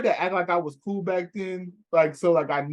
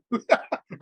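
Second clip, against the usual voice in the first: amused high laugh; short burst of laughter; 7 semitones higher than usual. Real, not acted. Friend laughing at the cover channel story, amused laugh.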